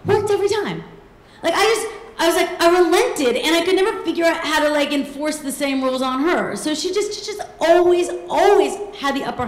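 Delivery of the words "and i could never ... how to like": ordinary talk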